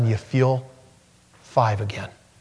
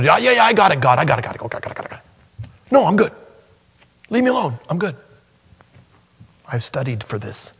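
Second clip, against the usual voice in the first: mock brush-off; 4 semitones higher, much faster than usual. Mocks a dismissive Jeremiah.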